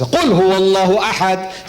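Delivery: rapid Arabic recitation, rattled off; Quranic recitation. Shown as a demonstration. Preacher quotes the Quran to a crowd, quick recitation. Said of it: "he doesn't talk like this".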